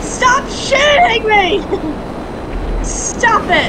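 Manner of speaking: high, slow, stretched-out plea; drawn-out protest. Real, not acted. Player begs friend to stop shooting, high drawn-out protest. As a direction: wail it in playful protest, dragging the words. Repeated plea while being shot.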